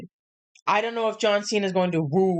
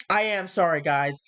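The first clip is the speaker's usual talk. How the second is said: ordinary delivery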